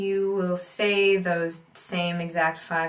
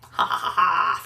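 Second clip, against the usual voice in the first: laughter; 5 dB louder than usual. Real, not acted.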